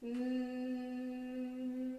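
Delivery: the n sound held long on one note, written "Hmm"; long, level hum of "Hmm"; isolated sound. Shown as a demonstration. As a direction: sustain "Hmm" as one steady nasal consonant. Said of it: "on retrouve le son".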